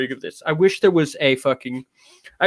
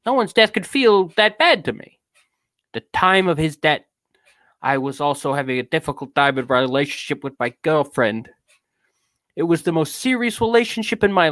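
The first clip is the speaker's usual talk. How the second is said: East Coast tough-guy character voice; put-on streetwise accent with punched words; Skinflick. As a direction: play a wounded wise guy telling his troubles. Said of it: "you should be Skinflick".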